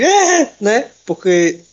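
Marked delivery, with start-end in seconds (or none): 0.0-0.5 high-pitched fan shriek; imitated crowd scream. Fan recounting the cinema crowd's scream, high mock shriek. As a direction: squeal like an excited movie audience. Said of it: "a gente começou o grito de".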